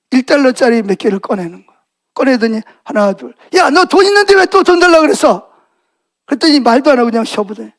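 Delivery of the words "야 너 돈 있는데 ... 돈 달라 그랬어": high, indignant outburst; cross scolding. Acted. Father catching his son with saved money, cross. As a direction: demand an explanation from the kid.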